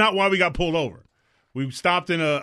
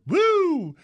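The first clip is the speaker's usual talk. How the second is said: high, loud whoop out the window; 5 dB louder than usual. Retold or imitated. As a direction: holler it like a joyride. Retelling the friend's whoop.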